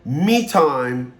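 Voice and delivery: ordinary talk, nothing marked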